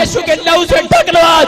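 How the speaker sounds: loud, rising sermon proclamation; fiery pulpit declaration; full-force preaching. Acted. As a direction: preach it out to the whole hall. Preacher shouting over music.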